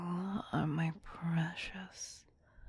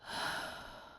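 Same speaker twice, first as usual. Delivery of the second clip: a soft, breathy sigh of regret; weary whispered sigh; 5 dB quieter than usual. Acted. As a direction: sigh as if the war weighs on her. Vampire queen mourning the war.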